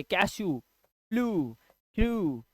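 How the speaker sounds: कैशू, फ्लू, क्रू with long drawn "oo", no y; oo sound. Shown as a demonstration. Teacher reading rule examples कैशू, फ्लू, क्रू, long oo. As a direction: slowly stretch the oo ending of कैशू, फ्लू, क्रू. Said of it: "ऊ का sound आपको देना है".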